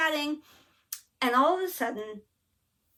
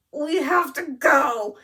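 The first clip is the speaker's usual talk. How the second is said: urgent; 5 semitones higher, 8 dB louder, much slower than usual. Retold or imitated. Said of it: "and I'm like".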